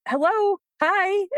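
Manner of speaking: hammed-up needy dog. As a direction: wave for attention as the dog. Dog voiced wanting attention.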